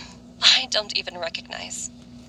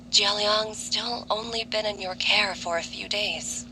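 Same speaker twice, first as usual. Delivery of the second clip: normal talking voice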